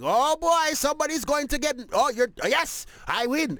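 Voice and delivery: high-pitched imitation of a gloating player; boastful domino player. Player hyping himself up before anyone has won, boastful. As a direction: crow about the win, hamming it up. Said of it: "are so boastful".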